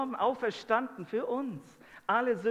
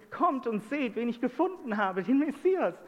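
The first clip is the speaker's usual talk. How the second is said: higher voice, building to a louder finish; eager, raised retelling of a joyful announcement; excited testimony; 4 semitones higher, 3 dB louder than usual. Retold or imitated. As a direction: imitate someone calling people to see her find.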